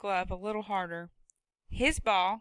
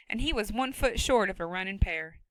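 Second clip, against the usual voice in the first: plain reading voice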